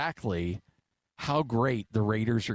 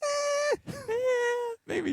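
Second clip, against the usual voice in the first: hammed-up fake whimper, much higher; mock crying; much slower than usual. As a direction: whine like a kid told to calm down. Mock whining about toning down.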